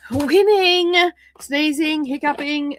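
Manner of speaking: high, loud sarcastic cheer; mock-triumphant "winning". Real, not acted. Sarcastic after hiccups.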